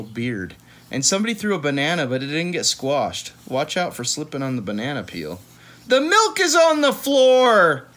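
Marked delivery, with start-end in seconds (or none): 5.9-7.9 high, slow shout of alarm; alarmed cry. Acted. Reading a picture book, shouting about the spilled milk.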